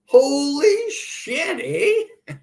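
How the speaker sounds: slow laughing exclamation; high, drawn out laugh; laughing disbelief. Real, not acted. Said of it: "laugh cry".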